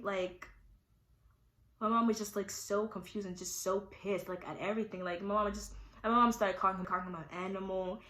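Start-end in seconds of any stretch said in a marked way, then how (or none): none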